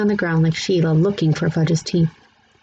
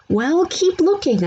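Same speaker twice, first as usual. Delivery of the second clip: yell; 11 semitones higher than usual. A narrator's reading. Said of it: "I yelled".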